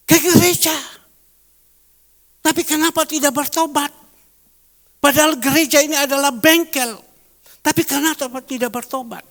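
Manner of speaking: loud, high preacher's call; fiery sermon voice; preaching at full force. Acted. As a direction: thunder it from the pulpit.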